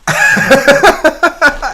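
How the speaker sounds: loud burst of laughter. Real, not acted. Laughs at own story.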